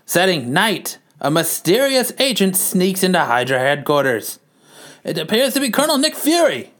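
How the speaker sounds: lightly voiced newsreel announcer; a hint of the old newsreel narration; old-timey narrator. A narrator's reading. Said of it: "me as the old-timey narrator".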